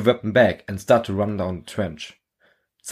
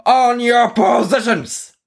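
much higher, louder, slow command; sergeant's yell; 5 dB louder, slower than usual. Acted. Sergeant ordering men to their positions, yelling. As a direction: bark the order down the trench. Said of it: "yells the sergeant".

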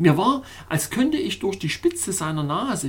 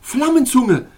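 loud, high bellow; 8 semitones higher, 8 dB louder than usual. A narrator's reading. Councillor bellowing after touching the hot hand. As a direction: roar the word out. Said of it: "brüllte Frosttyphus".

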